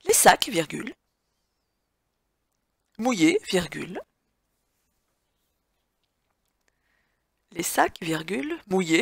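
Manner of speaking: dictating. Shown as a demonstration. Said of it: "dictée read to the class".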